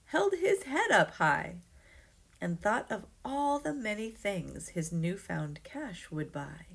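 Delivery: usual speaking voice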